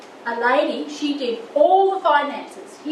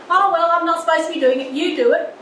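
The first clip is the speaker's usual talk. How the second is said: dismissive; 4 semitones higher, 6 dB louder, faster than usual. Retold or imitated. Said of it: "She just said".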